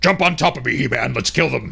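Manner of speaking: a hint of a fierce battle beast; lightly growled warrior-cat line. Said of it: "Battle Cat got fucking fierce".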